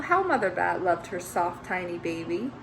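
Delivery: ordinary delivery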